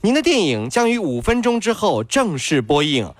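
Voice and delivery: 模仿检票员拖腔播报，音调偏高; 像车站广播一样一板一眼地通知观众入场; 大喇叭检票广播. Acted. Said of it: "检票口的小姐姐拿着大喇叭喊". (学检票口小姐姐用喇叭喊话)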